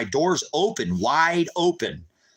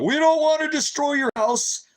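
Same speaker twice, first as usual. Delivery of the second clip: mocking the cops; 13 semitones higher, 3 dB louder than usual. Mocking the police's reassurance.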